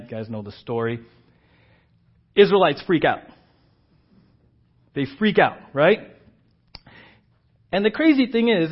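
ordinary talk, nothing marked

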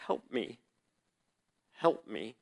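a scream kept inside, barely voiced; pleading under the breath; silent inner scream. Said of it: "screaming at God ... not audibly".

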